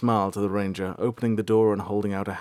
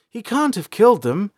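higher, louder, rushed denial; panicked; 10 semitones higher, 5 dB louder than usual. A narrator's reading. April panicking about her father, high and louder. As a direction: talk herself out of fear. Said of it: "April said, panicked".

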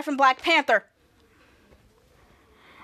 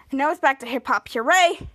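ordinary talk, nothing marked

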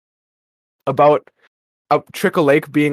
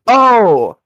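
loud, higher "oh" of dawning understanding; sudden realization; 7 semitones higher, 6 dB louder than usual. Retold or imitated. Listener realizing they aren't really camping, acted surprise. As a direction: let it burst out, pitched up.

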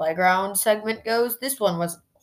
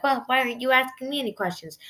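higher-pitched retold complaint; mock protest, pitched up; imitating Eva Marie; 6 semitones higher than usual. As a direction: put on a lightly higher voice to object. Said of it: "Eva Marie's like".

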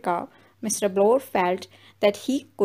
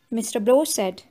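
ordinary delivery, nothing marked

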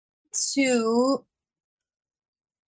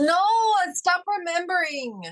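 playful protest; 11 semitones higher than usual. Real, not acted. Player protests rule being remembered.

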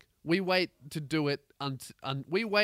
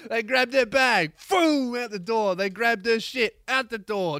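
loud, high, gleeful play-by-play; raised pitch, punchy and loud; excited re-enactment; 7 semitones higher, 7 dB louder than usual. Retold or imitated. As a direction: build the chaos at a shout. Excitedly acting out bags being thrown.

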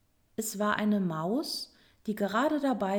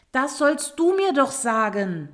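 higher, louder exclamation; raised-voice retort from the turtle; exclaiming; 4 semitones higher, 7 dB louder than usual. A narrator's reading. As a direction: call it out insistently. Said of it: "rief die Schildkröte".

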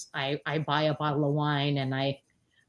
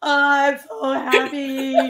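slow, high, put-on cartoon cat voice; Stimpy; 7 semitones higher, 7 dB louder, much slower than usual. Imitating Stimpy while scrubbing floors, slow and high. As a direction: ham up a giddy cartoon cat. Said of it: "I was like, Stimpy".

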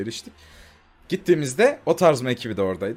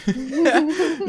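high laugh; 14 semitones higher than usual. Real, not acted. Laughing at the thumbnail.

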